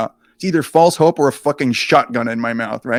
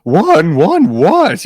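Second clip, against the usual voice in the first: much higher, louder baffled yelps; can't believe her ears; mother shocked on the other phone; startled mother; 5 semitones higher, 8 dB louder, much slower than usual. Acted.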